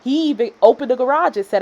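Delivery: ordinary talk, nothing marked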